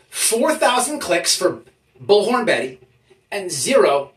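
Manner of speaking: ordinary talk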